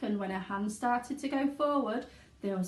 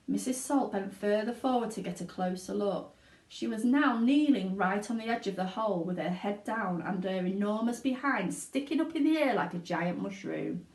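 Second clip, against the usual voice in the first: ordinary delivery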